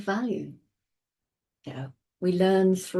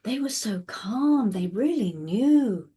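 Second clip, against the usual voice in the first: raised, amazed retelling with a slowed ending; awed praise; 3 semitones higher than usual. Retold or imitated. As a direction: marvel at how calm they were. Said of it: "They say".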